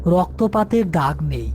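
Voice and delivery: plain reading voice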